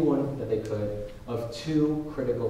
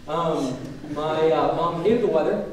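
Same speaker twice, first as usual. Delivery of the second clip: usual speaking voice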